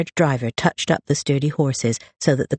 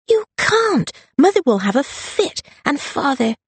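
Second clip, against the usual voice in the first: much higher, urgent alarmed cry; alarmed protest; 13 semitones higher than usual. Acted. Rosalind pleading with her eloping sister, alarmed and high. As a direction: panic at the news of the elopement.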